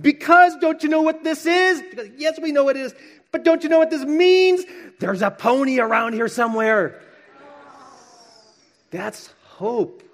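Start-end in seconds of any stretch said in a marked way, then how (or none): 0.0-1.8 high squealing delight, much louder; so excited. Acted. Little girl finds manure in her stocking, squealing with excitement. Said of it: "she gets so excited". 2.2-7.0 high squealing delight, much louder; so excited. Acted. Little girl finds manure in her stocking, squealing with excitement. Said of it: "she gets so excited".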